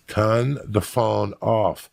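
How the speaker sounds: put-on drawn-out German-accented command. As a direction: imitate a German repeating an order slowly. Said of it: "A German guy".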